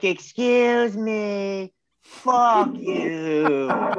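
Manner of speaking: high, drawn-out, mocking excuse me, then a shove of fuck you; drunken heckle. Drunk fan heckling a heel at her car, loud and mocking.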